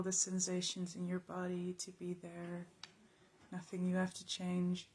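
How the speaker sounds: quiet, even meditation voice with long pauses; calm, unhurried, spacious delivery; guided meditation. Real, not acted. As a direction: guide them softly through noticing the body.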